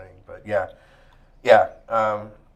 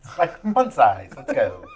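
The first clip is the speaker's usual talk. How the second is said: put-on Valley Girl voice; 6 dB louder than usual. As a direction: play the ditzy valley girl. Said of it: "There's that Valley Girl voice again".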